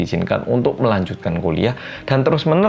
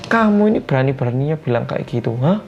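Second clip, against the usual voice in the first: angry mother; 8 semitones higher than usual. Retold or imitated. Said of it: "Mamanya marah balik".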